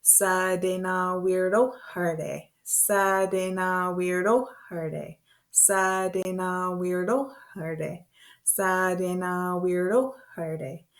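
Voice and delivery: "Sa de na weirdo herde" slow, chanted on an even note; on the beat. Shown as a demonstration. Teacher leading learners in rhythm, slow measured chant. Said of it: "say it with me and keep a beat".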